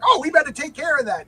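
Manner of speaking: high, breezy oblivious voice; husband shrugs off his bleeding wife, high and breezy; goofy sitcom husband. Retold or imitated. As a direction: toss it off like a sitcom dad.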